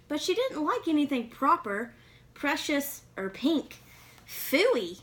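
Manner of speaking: put-on lively read-aloud for kids; storybook reading. A narrator's reading. Reading a picture book to young listeners, lively storytelling.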